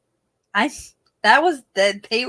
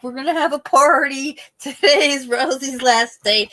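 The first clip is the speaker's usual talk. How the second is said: mock cheerful announcement; 6 semitones higher, 6 dB louder than usual. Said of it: "it's never a nice".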